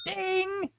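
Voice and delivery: high, quiet mouth-made ding. Pretend. Host fakes the sound effect himself, high ding. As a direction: imitate a cash register ding. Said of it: "Can we do the ka-ching sound effect?".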